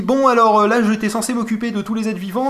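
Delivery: nervous high chatter, a bit rushed; Wheatley voice. Retold or imitated. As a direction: play the panicky little robot eye. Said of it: "qui vous dit".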